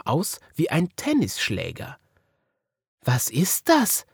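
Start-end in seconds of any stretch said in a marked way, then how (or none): 3.1-4.1 put-on high, slow puzzled child; a child staring at something odd, higher and slow; Marie wondering. A narrator's reading. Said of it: "fragte Marie".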